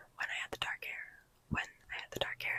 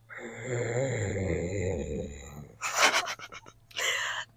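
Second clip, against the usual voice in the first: monster growl; 5 dB louder than usual. Pretend. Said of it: "I just sound like a monster".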